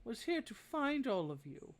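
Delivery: put-on high, fond old lady voice; old woman. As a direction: pitch up into a grandmotherly old woman. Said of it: "she kind of pats it on the head".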